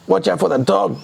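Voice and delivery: a jumpy thief hissing at his partner; burglar voice. A narrator's reading.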